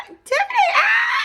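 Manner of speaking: very high, loud excited shriek; imitated girly squeal of delight; squealing reunion. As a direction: scream the name like a long-lost friend. Acts out friends' squealing reunion.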